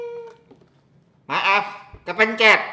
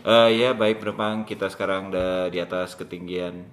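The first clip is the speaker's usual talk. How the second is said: pilot announcement; 10 semitones lower, 4 dB quieter than usual. Pretend.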